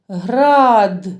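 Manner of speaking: "град" drawn out, final "д" set apart; sounding out. Shown as a demonstration. Teacher helps children find the fourth sound, sounding out "град". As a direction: stretch "град" and finish on a clear "д". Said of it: "Четвертий звук".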